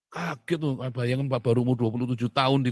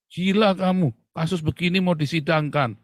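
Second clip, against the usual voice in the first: imitating a police chief yelling, higher and louder; angry outburst; 6 semitones higher, 5 dB louder than usual. Police chief scolding the investigator, mock furious. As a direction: bark it like an outraged chief.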